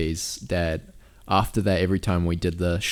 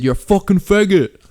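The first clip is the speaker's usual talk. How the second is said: much higher, louder mock insult; imitating an abusive yell; 10 semitones higher, 7 dB louder than usual. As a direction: spit it like the angry stranger. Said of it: "He said".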